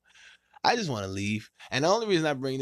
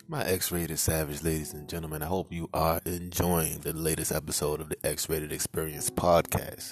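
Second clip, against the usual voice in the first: sincere sign-off; 8 semitones lower than usual. Acted. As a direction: speak like a heartfelt host sign-off. Recorded-style sign-off message.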